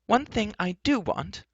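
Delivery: high, slow, emphatic retort; high-voiced defiant heroine; sharp retort. Heroine answering the enchantress, retorting. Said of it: "retorted Elenwy".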